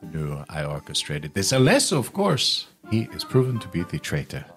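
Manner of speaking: delighted, then carefully deliberate merchant; scheming merchant. Acted. Merchant agrees the contract with a clever friend, scheming. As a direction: relish the scheme with sudden pitch swoops. Game master voices Jubal agreeing.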